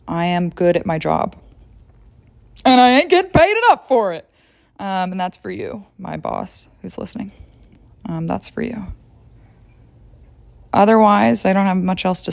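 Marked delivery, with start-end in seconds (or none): 2.6-4.3 higher and louder, sassy complaint; sassy jab. Real, not acted. Jab at boss. 4.8-8.8 softer, pointed aside to the boss. Real, not acted.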